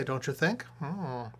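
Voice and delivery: usual speaking voice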